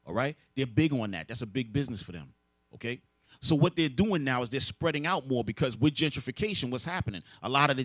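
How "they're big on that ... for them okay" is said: ordinary talk, nothing marked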